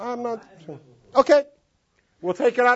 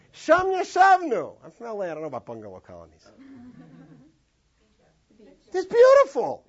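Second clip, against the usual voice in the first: naive dreamy reader; 3 semitones higher than usual. Pretend. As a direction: play someone who thinks it's a lovely riverside scene. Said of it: "what's your imagery right now".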